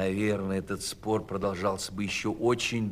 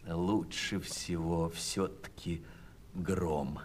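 softer, slow and sleepy; sleepy hen; 4 semitones lower, 6 dB quieter, slower than usual. Acted. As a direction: drift off while saying it. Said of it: "подумала она и заснула". (сонно и тихо)

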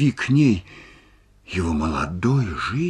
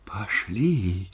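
softer, dull call through a door; muffled voice; 5 dB quieter than usual. A narrator's reading. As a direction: say it quietly and hollowly, as from behind a door. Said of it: "глухо послышался его голос". (глухим, слабым голосом)

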